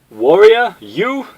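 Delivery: very high, loud scoffing; scornful mockery. Guile mocking Bison's claim to be a warrior, high scoffing. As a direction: sneer in disbelief.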